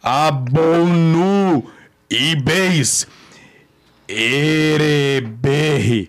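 slow, drawn-out reversed gibberish; speaking backwards. Acted. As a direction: sound out each word as if a tape played in reverse. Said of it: "falando essas coisas ao contrário".

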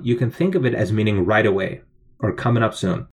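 usual speaking voice

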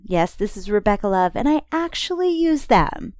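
upbeat radio ad voice; ad read. Acted. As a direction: read it like a sponsor spot. Sponsor ad read.

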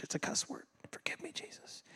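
hushed, playful aside; mock penitent whisper. Preacher who said stupid in church, whispering penitently. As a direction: whisper it like a guilty little prayer. Mock confession after saying cuss word.

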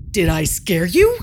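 loud, teasing question; playful tiger asking loudly. A narrator's reading. Tiger teasing Jardad after the staring contest, playful and loud. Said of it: "he asked as he burst out laughing".

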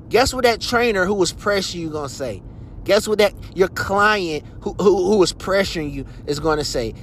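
rant. Real, not acted. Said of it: "I've been on a little rant today".

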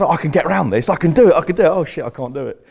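high, loud self-urging, then dropping in defeat; mad overconfidence. Retold or imitated. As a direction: egg yourself on, then give up. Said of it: "But I had this mad".

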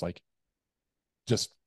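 normal talking voice

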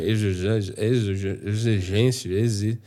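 stumbling over "exigência" with repeated false starts; tongue-tie "exige-, exigência, exi-" into slurred broken attempts; tropeçando na palavra. Shown as a demonstration. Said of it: "porque senão sai". (tropeçando e embolando as sílabas de "exigência")

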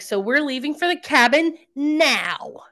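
imitated tantrum, slow and emphatic; throwing a fit. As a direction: act out a mock fit, dragging out the demand. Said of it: "is basically throwing a fit".

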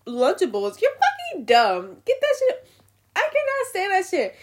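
loud, heated rant. Real, not acted. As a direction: shout it out in disgust. Ranting at Lunchable parents.

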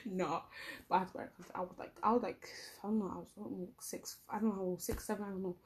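usual speaking voice

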